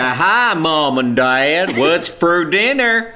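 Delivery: put-on high, very slow caricature American accent; mock American accent. Father imagining daughter at dinner, hammed-up American accent. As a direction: draw out every word like an American kid. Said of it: "when she's American".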